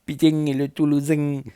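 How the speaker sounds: Toulouse accent, nasal endings ringing out. Shown as a demonstration. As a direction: put on a thick Toulouse accent. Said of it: "avec l'accent".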